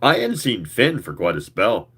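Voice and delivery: usual speaking voice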